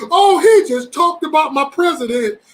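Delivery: high, loud, drawn-out mock indignation; mock outrage. Mocking people upset over Trump talk, hammed-up outrage. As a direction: mimic an offended supporter, slow and loud. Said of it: "I'm not one of the motherfuckers like".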